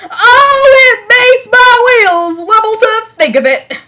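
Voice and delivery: high, loud, wailing dread; mock fear. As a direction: exaggerate a frightened wobble of alarm. Acted engine mock-fearful of ghost story.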